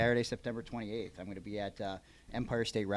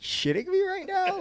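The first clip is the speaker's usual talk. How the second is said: incredulous retort; 7 dB louder than usual. Retold or imitated. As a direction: retell your own stunned reaction, voice shooting up. Retells his disbelief at the question.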